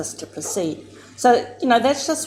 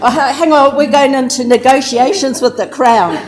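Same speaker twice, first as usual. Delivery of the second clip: laughing protest; 6 semitones higher, 10 dB louder than usual. Retold or imitated. Negotiator laughs at the aunties.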